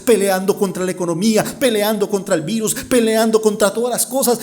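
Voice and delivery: building from even to high, insistent preaching; fervent preaching. Real, not acted. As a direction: pile up each struggle, rising in intensity. (predicación insistente que va subiendo)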